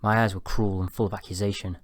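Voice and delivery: ordinary delivery, nothing marked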